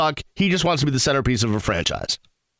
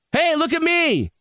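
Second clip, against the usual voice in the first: high, showy "hey, look at me" in a mocking voice; a lightly hammed-up show-off; mock attention-seeker; 12 semitones higher than usual. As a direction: parody a star begging for attention. Mocking attention-seeking star.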